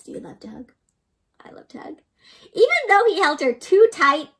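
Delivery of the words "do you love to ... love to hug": unvoiced soft whisper; hushed tender whisper. Real, not acted. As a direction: whisper it like a secret.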